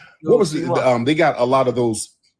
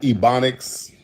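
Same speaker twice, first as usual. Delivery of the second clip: ordinary delivery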